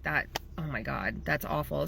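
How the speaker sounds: retold hushed sympathy, mildly stricken; devastated. Photographer hearing client's cancer news, quietly devastated. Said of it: "immediately I'm devastated for her".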